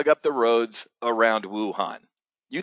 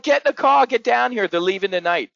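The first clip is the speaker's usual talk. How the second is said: much higher and louder, a frantic order; urgent yell; 9 semitones higher, 5 dB louder than usual. Retold or imitated. Father calling his kids to get down here, urgent yell. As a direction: shout at the kids to drive down now. Telling kids to come now.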